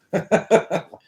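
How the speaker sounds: a short, high, loud laugh. Real, not acted. Admitting she's not from Liverpool, high loud laugh. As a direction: burst out laughing at herself.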